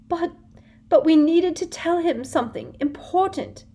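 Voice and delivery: high, loud fake wail. A narrator's reading. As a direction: wail it in mock despair. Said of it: "wailed Hermione".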